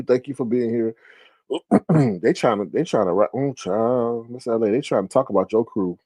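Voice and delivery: usual speaking voice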